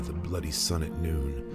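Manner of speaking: ordinary delivery, nothing marked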